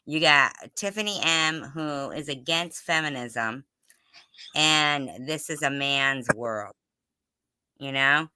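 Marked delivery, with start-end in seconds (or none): none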